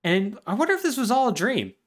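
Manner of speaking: high, dazed talk to his dog; wondering boy. Retold or imitated. Boy back home talking to his dog, high and dazed. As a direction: marvel aloud to the dog. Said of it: "he looks at his dog and is like".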